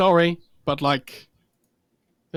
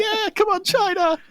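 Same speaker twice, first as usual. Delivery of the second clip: high-pitched imitation of a fan cheering; mock crowd cheer, voice way up; slower than usual. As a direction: chant it high like a supporter in the arena. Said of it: "cheering for V P".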